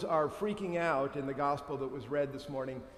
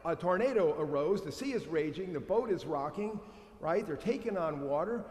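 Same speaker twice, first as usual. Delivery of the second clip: ordinary delivery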